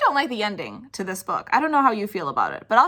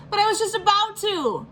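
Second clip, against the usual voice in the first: put-on higher childish protest; whiny kid voice; 11 semitones higher, 3 dB louder than usual. Spencer protesting to mom, higher childish whine. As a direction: protest like a stubborn little boy.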